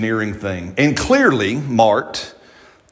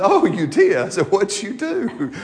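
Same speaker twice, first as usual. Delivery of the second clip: pitched way up, drawn-out mock surprise; 9 semitones higher than usual. Father reacts to his son blowing the breaker, in mock surprise. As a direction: play it amazed and amused.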